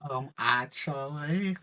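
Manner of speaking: smug know-it-all nerd voice; nerdy "actually" voice. Pretend. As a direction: play the nasal nerd correcting someone. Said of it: "that's the kind of nerd".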